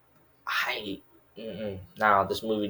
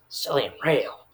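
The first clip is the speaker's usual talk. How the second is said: "Selling Rail" said higher, rising at the end; exaggerated repeat; 5 semitones higher, slower than usual. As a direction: lift "Rail" in a mock-impressed repeat. Repeats the font name, exaggerated.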